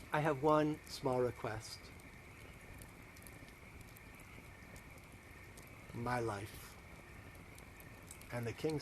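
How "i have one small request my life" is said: low, hushed, grave request; grave plea. Acted. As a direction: plead softly, with a long pause before the last words. Esther gravely pleads for her life.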